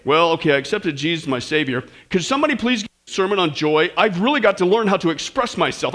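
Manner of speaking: low, subdued mock testimony; deadpan imitation of a reluctant believer; joyless new convert. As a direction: play someone unmoved by good news. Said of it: "Or did you have to say".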